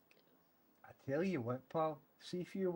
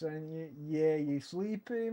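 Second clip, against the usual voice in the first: ordinary talk, nothing marked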